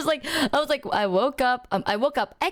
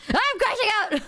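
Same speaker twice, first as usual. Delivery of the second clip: mock crash-out; 9 semitones higher than usual. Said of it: "Ah, eight hours".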